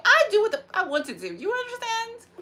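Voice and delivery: loud and high. Pretend. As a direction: assert it shrilly.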